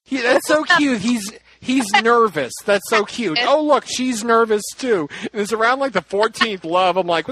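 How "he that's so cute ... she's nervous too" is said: high-pitched cooing reaction; retold gushing. Listener charmed by a stuttering confession, gushing. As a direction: gush over it, pitched up.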